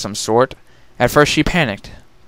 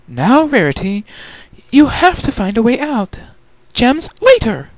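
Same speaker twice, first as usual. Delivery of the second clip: much higher, firm pep talk to herself; self-scolding lady; 13 semitones higher than usual. Acted. Rarity urging herself to leave the gems, higher and firm. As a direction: pull yourself together.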